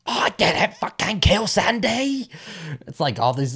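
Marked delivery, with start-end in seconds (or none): 0.1-2.3 slow, high, loud hammed-up Cockney; Cockney accent. Old man denying killing Sandy, indignant hammy Cockney accent. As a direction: stretch it out in an outraged mock Cockney. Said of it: "the Cockney accents".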